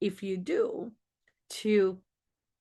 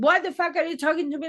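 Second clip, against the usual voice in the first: mock angry snap; 6 semitones higher, 4 dB louder, much faster than usual. Coworker snapping back.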